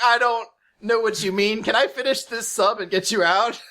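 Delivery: mock-puzzled customer service; bewildered clerk. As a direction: plead politely with a crazy customer. Bewildered sandwich clerk replies.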